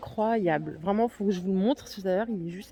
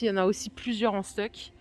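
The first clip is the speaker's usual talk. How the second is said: ordinary delivery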